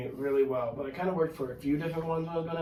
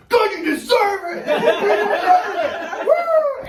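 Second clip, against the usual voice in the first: high, loud, drawn-out taunting yell; gloating heel shout; 10 dB louder, much slower than usual. Retold or imitated. Heel wrestler taunting the booing crowd, loud gloating yell. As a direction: crow it at the crowd like a villain.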